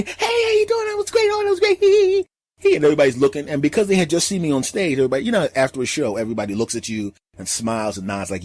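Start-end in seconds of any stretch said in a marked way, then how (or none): 0.0-2.8 put-on very high, giggling kid; giddy kid voice. The boy spots his hero and giggles, very high and giddy. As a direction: squeal it out like a starstruck little boy.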